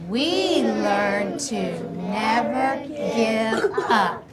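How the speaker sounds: very drawn-out, even words; slow choral reading. Shown as a demonstration. Teacher leads class to the end of the message, slow and drawn-out. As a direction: stretch each word for group reading.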